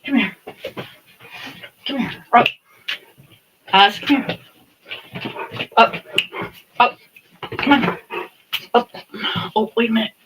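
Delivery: loud, high pet talk. Real, not acted. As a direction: call the dog up sweetly. Calling the dog Oz over.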